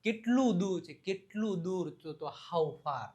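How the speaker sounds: normal talking voice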